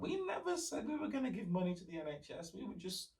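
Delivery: mock the Brexit campaigners' backpedalling; a snide, high mock politician denying everything. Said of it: "And then they were like".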